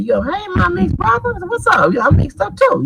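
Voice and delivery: high, bright-pitched hype greeting to a friend; high excited greeting. Retold or imitated. Narrator greeting the mixed brother, high and excited. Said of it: "I said".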